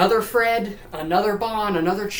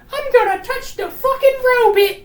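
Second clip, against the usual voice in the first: very high, loud squeal; hammed-up squeaky child defiance; defiant little girl; 7 dB louder than usual. Daughter disobeying once father leaves, high and loud mock squeal. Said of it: "and she goes over".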